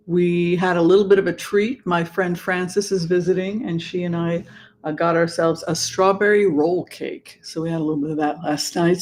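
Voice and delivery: usual speaking voice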